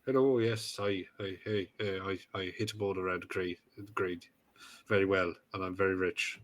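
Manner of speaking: mock-boring golfer monotone; dull, soft-spoken sportsman parody; Rory McIlroy. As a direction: drone it out quietly, stammering over the start. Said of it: "Ugh, boring people".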